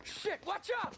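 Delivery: high urgent shout; warning yell. Acted. Owner shouting a warning mid-gunfight. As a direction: yell it as bullets fly.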